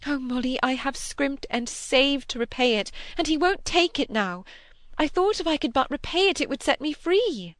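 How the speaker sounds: high-pitched, pleading distress; distressed lament. A narrator's reading. Cynthia tells Molly of the unpaid debt, in high distress.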